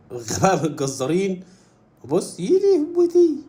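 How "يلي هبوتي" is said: high, drawn-out cry of shock; mock wail. Onlooker watching the animal go flying, hammed-up wail. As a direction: stretch out a comic lament.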